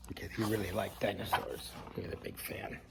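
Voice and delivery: quick murmured aside to the listeners; soft, rushed side comment; hushed aside. Real, not acted. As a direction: drop out of the story and mutter it fast. Quiet fast aside to listeners.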